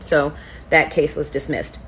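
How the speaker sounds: normal talking voice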